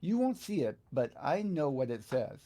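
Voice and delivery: ordinary talk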